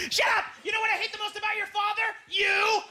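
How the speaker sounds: high mock-furious rant; losing her mind. Mother ranting at her child about the father, losing her mind. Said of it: "she's, she's losing her mind".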